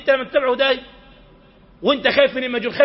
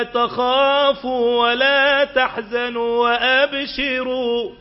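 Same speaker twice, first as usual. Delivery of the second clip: slow melodic chanted recitation; Quran recitation; 3 semitones higher, much slower than usual. Acted. Preacher chanting a verse to the congregation, slow and melodic. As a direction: chant the verse slowly and tunefully.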